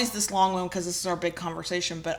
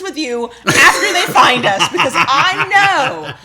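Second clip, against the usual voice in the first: louder, laughing thanks; real laughter while thanking fans; 6 semitones higher, 9 dB louder than usual. Real, not acted.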